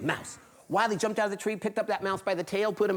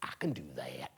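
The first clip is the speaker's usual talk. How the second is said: whisper; 11 dB quieter than usual. Acted. Hairy man boasting.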